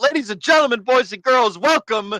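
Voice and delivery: loud, high hosting voice; show host intro. Acted. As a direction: open the show with flair. Show opening.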